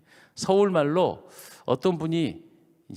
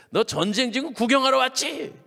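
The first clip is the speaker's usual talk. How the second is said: high-pitched accusing jab; scornful rebuke; 9 semitones higher than usual. Retold or imitated. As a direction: put a younger brother down, acted out. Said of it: "이렇게 면박을 주는 거예요". (높은 소리로 면박을 주듯이)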